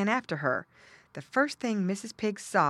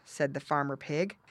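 ordinary delivery

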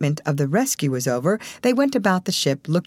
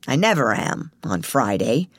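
grumbling; 4 semitones lower than usual. A narrator's reading. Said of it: "grumbled Mister Sneed".

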